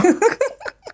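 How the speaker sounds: a short, high, embarrassed laugh; a quick high giggle after admitting tears. Real, not acted. Laugh after recalling crying.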